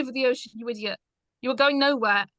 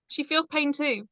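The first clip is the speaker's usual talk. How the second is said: ordinary talk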